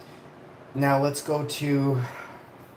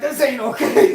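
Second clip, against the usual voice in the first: laughing quote; 11 semitones higher, 8 dB louder than usual. Retold or imitated. Retelling finding smoke-filled house.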